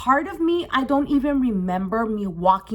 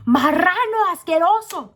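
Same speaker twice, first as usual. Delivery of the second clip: high, loud burst of disgust; going off; 8 semitones higher, 5 dB louder than usual. Retold or imitated. Teller to the man who touched her friend, going off. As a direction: spit out the insult loudly. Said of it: "I'm going off on this motherfucker".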